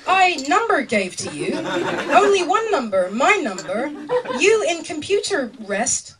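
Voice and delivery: slow, loud, high broken sentences; bad-German pleading. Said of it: "my German is really, really bad".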